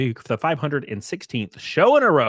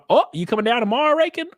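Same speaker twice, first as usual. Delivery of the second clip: high, rising excited question; perked-up call to a friend; surprised greeting; 9 semitones higher, 3 dB louder, faster than usual. Real, not acted. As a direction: pitch jumps up in happy surprise.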